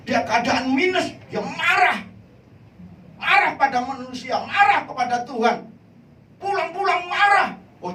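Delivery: high, loud preacher's cry; loud, high-pitched sermon climax; fiery preaching. Acted. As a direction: thunder it at the congregation. Preaching at full force.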